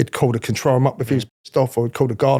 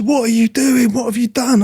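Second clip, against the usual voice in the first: high, frantic imitation of a flustered officer; mock-panicked what have you done; panicked protection officer; 8 semitones higher than usual. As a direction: squeal it like he's about to burst into tears. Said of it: "he goes".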